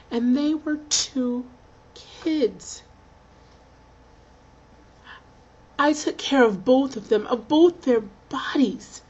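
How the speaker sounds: moved recollection, pausing between phrases; deeply moved. Acted. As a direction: let each phrase land slowly, pitch lifted with feeling. Moved recalling both patients.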